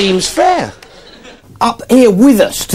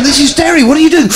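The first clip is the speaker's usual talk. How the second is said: raised, incredulous comeback to a crowd; incredulous retort; 7 semitones higher, 3 dB louder, faster than usual. Retold or imitated. Comic telling a Derry crowd off, incredulous. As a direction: throw it back at them in disbelief. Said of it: "And I said to them".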